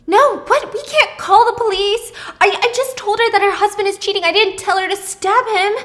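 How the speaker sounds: loud, high, panicky denial; panicked protest. Acted. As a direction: panic and refuse to call the police. Pippa panicking.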